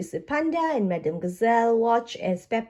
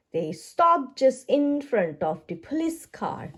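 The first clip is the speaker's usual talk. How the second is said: usual speaking voice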